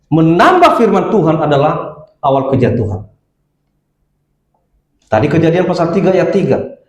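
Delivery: ordinary talk, nothing marked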